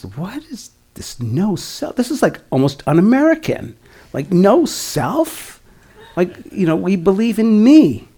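high, rising disbelief; mock an outraged American; incredulous.

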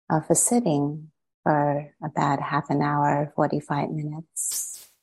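calm, unhurried meditation guide with long pauses; gently measured meditation voice, settling phrase by phrase; guided meditation. Acted. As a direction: ease listeners into relaxing, leaving space after each phrase.

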